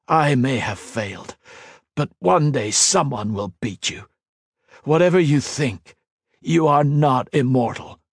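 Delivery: chained hero defying the emperor, resolute; defiant hero. A narrator's reading. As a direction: stand firm though beaten.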